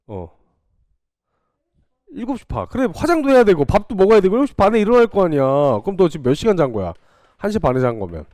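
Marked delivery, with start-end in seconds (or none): 2.7-5.4 fast animated listing. Real, not acted. Teacher lists morning routine.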